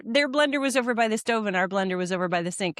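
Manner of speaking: usual speaking voice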